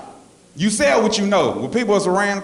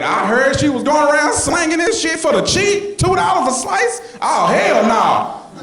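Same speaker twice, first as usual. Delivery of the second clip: outraged; 8 semitones higher, slower than usual. Real, not acted. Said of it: "outraged at rival's prices".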